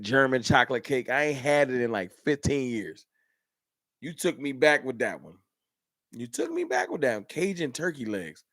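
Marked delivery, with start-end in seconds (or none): 6.1-7.2 high mock voice. Real, not acted. Repeats line in new voice.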